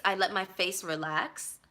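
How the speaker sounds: ordinary delivery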